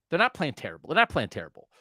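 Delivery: ordinary delivery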